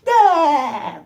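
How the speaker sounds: high, loud cartoon laugh attempt, cut short; failed SpongeBob laugh. Reviewer attempting SpongeBob's laugh, badly faked. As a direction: blurt a squeaky laugh and give up. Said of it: "I can't do it".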